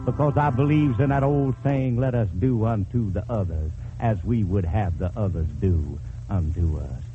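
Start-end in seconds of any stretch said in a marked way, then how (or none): none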